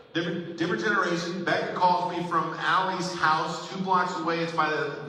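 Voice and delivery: ordinary delivery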